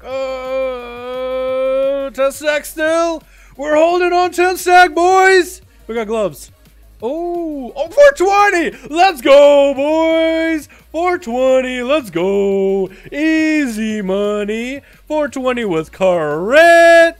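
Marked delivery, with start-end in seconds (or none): 0.0-5.6 hyped high shout; frat bro. Real, not acted. Hype over ten stack buff. 7.0-17.1 stretched-out hype yell; frat bro. Real, not acted. Hyped loot celebration.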